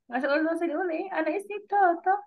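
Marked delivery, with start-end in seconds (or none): 1.1-2.2 put-on high, babyish "طا طا" with the ط gone light; cutesy weak ta. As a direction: lose all the weight of ط in a high-pitched "طا طا". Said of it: "طا طا دي خالص ضايعة".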